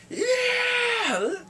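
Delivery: high, loud rising cheer; triumphant yell. Real, not acted. Viewer cheering the execution.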